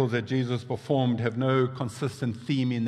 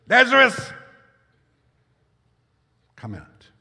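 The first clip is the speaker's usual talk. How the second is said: loud cry; 12 semitones higher, 11 dB louder than usual. A narrator's reading. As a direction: call the dead man out of the tomb with a great shout. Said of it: "he cried out in a loud voice".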